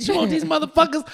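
high-pitched goading question; mock taunt. Storyteller replaying himself holding her CDs hostage, high taunting tone.

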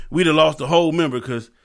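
usual speaking voice

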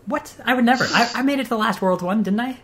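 high, indignant protest with a laugh; mock outrage. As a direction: act shocked at the accusation. Denies falling asleep, mock outrage.

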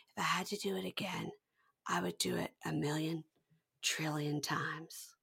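hushed whispered vow. Retold or imitated. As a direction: breathe it out in a whisper. Quoted line delivered whispered.

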